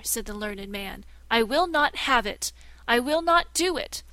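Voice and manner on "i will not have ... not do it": loud and higher, hammering each refusal; shouting no at the usurper; angry refusal. Acted. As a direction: plant your feet and refuse. Learned man refuses.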